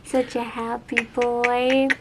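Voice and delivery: slow, tender praise for the pet. Real, not acted. Cooing at cat.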